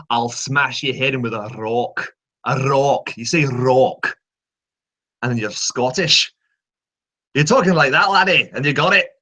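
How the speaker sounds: Scottish accent with a rolled R on "rock". Shown as a demonstration. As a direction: demonstrate a lively Scottish accent. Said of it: "my trigger for Scottish".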